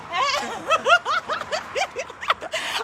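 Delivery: high giddy laughter; excited laugh. Real, not acted. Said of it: "I'm so excited".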